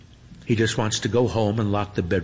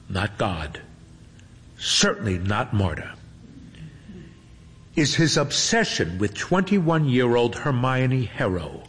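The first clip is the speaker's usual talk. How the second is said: ordinary delivery, nothing marked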